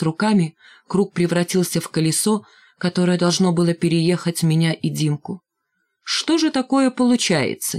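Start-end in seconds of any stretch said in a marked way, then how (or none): 6.0-7.8 high, indignant protest; insistent. A narrator's reading. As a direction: keep at it, refusing to calm down. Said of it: "не унимался Валька". (не унимаясь, высоким голосом)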